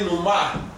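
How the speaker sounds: "numa" stressed on the final syllable, nu-MA; stress on second syllable. Shown as a demonstration. Teacher asks class about the accent, "numa" stressed at the end. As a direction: lean on the last syllable of "numa". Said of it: "numa and numa, it writes the same way".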